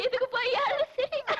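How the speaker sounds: loud amused outburst; helpless giggly question; laughing. Acted. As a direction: speak through shared laughter. Both friends burst out laughing.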